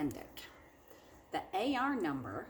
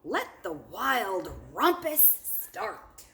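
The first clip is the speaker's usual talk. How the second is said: cried out; 5 semitones higher, 9 dB louder, slower than usual. Acted. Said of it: "Max cried".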